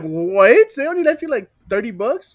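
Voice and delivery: high, disbelieving outburst; shocked at the tiny tip; incredulous. Retold or imitated. Retold outrage over small tip.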